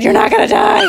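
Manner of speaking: very high, loud squeal; hammed-up panicked insistence; frantic reassurance. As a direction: shout it back in acted panic. Shrill argument in a panic.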